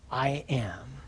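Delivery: near-whispered, solemn pronouncement; soft, voiceless and weighty; hushed declaration. Acted. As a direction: let it drop almost to a whisper. Hushed climax of quoted scripture.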